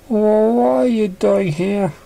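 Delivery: high, slow, tight pony voice fighting tears; holding back a crack. Acted. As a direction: keep it together, barely. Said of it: "keep her voice from cracking".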